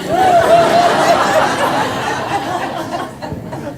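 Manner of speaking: real laugh, rising then falling; high hearty laugh. Real, not acted. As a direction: let out a big high laugh. Big laugh at the crowd.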